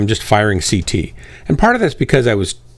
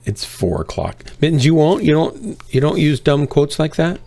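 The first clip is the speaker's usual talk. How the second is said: ordinary talk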